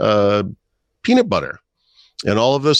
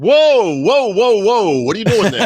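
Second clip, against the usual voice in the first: high, loud New Jersey gas attendant yelling whoa; New Jersey accent; 11 semitones higher, 5 dB louder, slower than usual. Retold or imitated. Gas attendant stopping a driver at the pump, loud New Jersey accent. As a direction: shout it like a startled New Jersey pump guy. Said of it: "With the New Jersey accent".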